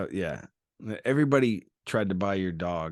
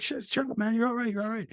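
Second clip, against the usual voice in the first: high, hammed-up soothing voice; squeaky mock "you're all right"; high mock reassurance; faster than usual. Retold hushing the hurt kid.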